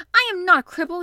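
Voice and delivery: high, very loud indignant outburst; furious cry. A narrator's reading. Colin sitting bolt upright to deny it, furious. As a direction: cry out in fury. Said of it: "he cried out furiously".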